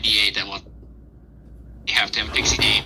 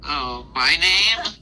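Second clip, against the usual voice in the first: pixie; 5 semitones higher than usual. Acted. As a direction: draw out the name like a proud little pixie. Pixie introduces himself.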